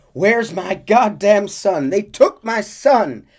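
high, loud, slow frantic cry; a frantic mother demanding her stolen son. Acted. Abigail frantic and shrieking for her son. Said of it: "Abigail emerges frantic".

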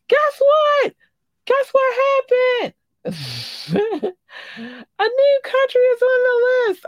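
very high, loud squeal; excited announcement. Acted. Host telling Boo about a new country, excited squeal. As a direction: burst out squealing with big news. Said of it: "I do this".

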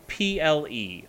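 P L E as three slow separate letters; spelling out loud. Shown as a demonstration. Host spelling the odd name for co-host, P L E slowly letter by letter. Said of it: "it's spelled".

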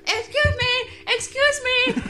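put-on high, timid little old lady; man doing granny voice. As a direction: squeak it like a frail granny. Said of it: "So I was like".

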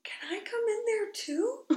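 put-on high small kid voice; high mock child request; child asking. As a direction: ask timidly like a little child. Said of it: "they were like".

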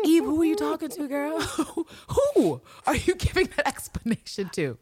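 high-pitched teasing query; mock incredulous questioning. Podcaster asking Eve who she is talking to, high mock bafflement. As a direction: question her in playful, high-pitched disbelief.

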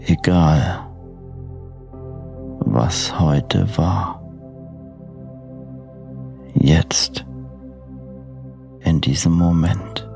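soft, slow sleep meditation voice; guided meditation. Acted. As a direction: lull the listener toward sleep gently. Freigesprochene Einschlafmeditation.

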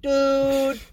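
mock fed-up viewer protesting; exasperated dude. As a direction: plead with rising frustration. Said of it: "'Cause I was getting mad".

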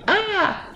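piercing high bird shriek; harpy eagle screech. Retold or imitated. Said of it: "the harpy eagle when it was like".

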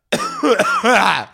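high sudden cough. Real, not acted. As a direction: cough mid-story. Cough mid-story.

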